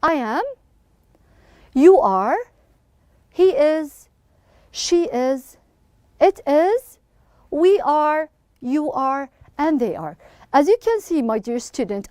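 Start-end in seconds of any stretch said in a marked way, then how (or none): 0.0-10.2 short phrases evenly spaced with pauses; measured grammar recitation; read-along drill. Shown as a demonstration. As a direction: lead the class in repeating each phrase. Teacher leads second group reading.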